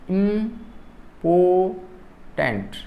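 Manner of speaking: "important" very slowly as im, por, tant, with gaps; syllable by syllable. Shown as a demonstration. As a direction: break "important" into three separate beats. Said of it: "three syllables".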